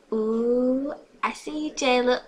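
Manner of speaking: high, loud teasing "ooh". A narrator's reading. Friend teases Jayla about the boy, playful.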